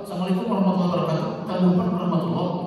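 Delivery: fast clipped greeting; rushed salam. Shown as a demonstration. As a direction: toss it off quickly. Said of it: "nggak ada". (salam tergesa-gesa)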